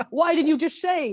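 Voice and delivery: high, put-on indignant question; exasperated shopkeeper. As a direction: imitate an exasperated vendor. Retells exasperated shopkeeper's reply.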